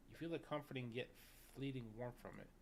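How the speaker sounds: ordinary delivery, nothing marked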